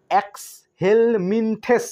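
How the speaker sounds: এক্সহেলমিনথেস very slowly, syllable by syllable, ending in thes. Shown as a demonstration. Teacher reading the book's spelling, এক্সহেলমিনথেস slowly ending in thes. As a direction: stretch out each part of এক্সহেলমিনথেস, final vowel e. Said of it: "এখানটায় ওরা থেস বলছে".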